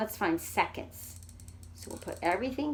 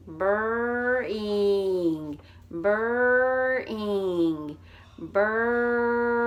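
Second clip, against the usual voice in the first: each "burring" held long and louder; drawn-out burring; 5 dB louder, much slower than usual. Shown as a demonstration. Teacher modelling the next word, drawn out. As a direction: stretch the r slowly. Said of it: "The next one is".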